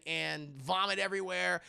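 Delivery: ordinary delivery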